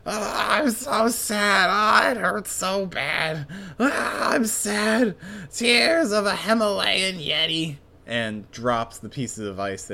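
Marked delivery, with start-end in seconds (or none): 0.0-7.8 high, slow hammed-up sobbing; fake crying. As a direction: ham up a fake cry, drawn out. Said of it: "you gotta pretend to cry with me".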